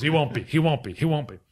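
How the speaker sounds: mildly heated imitation of a vice principal; red-faced anger. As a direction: repeat it like an outraged official. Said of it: "he's just red-faced".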